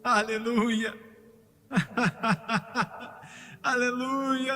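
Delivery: ordinary delivery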